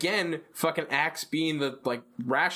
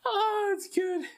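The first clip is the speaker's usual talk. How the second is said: much higher, slow put-on voice; mock delighted; slower than usual. Podcaster relishing the cartoon shot, high and slow. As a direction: savor it in a silly high voice.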